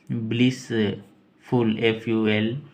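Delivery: Blissful stretched very slowly; slow spelled out. Shown as a demonstration. Teacher to students spelling word, slow careful pronunciation. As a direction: draw out blissful syllable by syllable. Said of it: "ब आधा".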